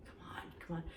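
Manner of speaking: unvoiced, urgent come-ons under her breath; whispered pleading. Nurse urging the procedure under her breath, whispered. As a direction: whisper it like willing something to work.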